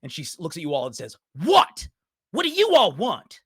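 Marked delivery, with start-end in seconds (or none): 1.4-3.4 loud, high, furious snap; angry young girl yelling; filled with fury. Acted. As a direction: lash out at the onlookers. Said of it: "as she is filled with fury".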